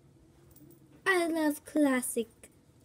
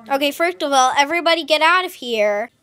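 loud bossy order to leave; 4 semitones higher, 15 dB louder than usual. Pretend. Cat leader ordering everyone out, loud and bossy. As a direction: clear the room with a loud order.

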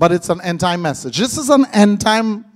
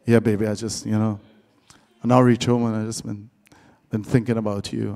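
much lower, soft seductive crooning; put-on deep voice; 12 semitones lower, 7 dB quieter, faster than usual. Man wooing a woman on the phone, deep soft crooning. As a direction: lay on the fake deep voice. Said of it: "They put on the God voice".

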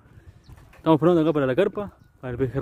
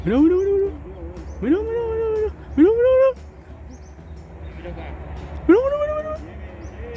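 agudísimo y muy emocionado; excitación. Real, not acted. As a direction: grita de emoción viendo el pique. El pez tira de la línea. ("mirá" repetido muy agudo y ansioso)

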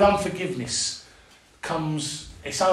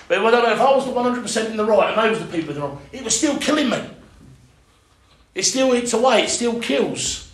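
fiery preaching; 7 semitones higher, 4 dB louder than usual. Real, not acted. Preaching builds.

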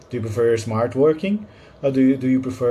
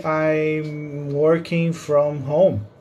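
drawn-out, higher-pitched teaching delivery; slow model phrase; 4 semitones higher, much slower than usual. Shown as a demonstration. Teacher giving learners the correct English phrase, slow and drawn out. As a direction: say it very slowly for learners to copy. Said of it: "in English you have to say".

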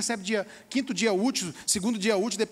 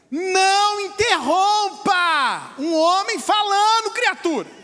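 slow, stern, a touch louder; stern mock command; 4 semitones higher, 4 dB louder, much slower than usual. Pastor mimics a man scolding his wife, slow and stern. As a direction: lay down the law like an offended husband.

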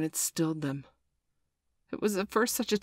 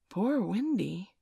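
puzzled echo; 4 semitones higher, slower than usual. A narrator's reading. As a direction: wonder aloud, unsure what it means. Puzzled boys repeat the name.

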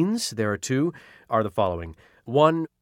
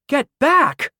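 incredulous woman; 6 dB louder than usual. Acted. Mari questions Caro.

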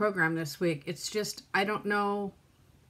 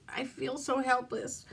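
softer, fading repeat of "helpless"; quiet crying; 2 semitones higher, 5 dB quieter than usual. Real, not acted. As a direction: whimper "I feel so helpless" under her breath. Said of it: "sit here and cry on camera".

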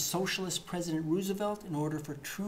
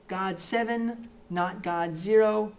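slow, louder scoreboard call; emphatic score; 3 semitones higher, 5 dB louder, slower than usual. Acted. Comic host triumphantly calling God's score. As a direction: announce the score with weight.

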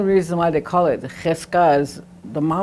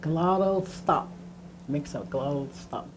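usual speaking voice

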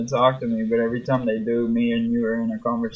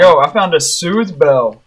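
loud happy announcement; excited; 2 semitones higher, 8 dB louder than usual. Real, not acted. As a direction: burst out with the good news. Excited find of item.

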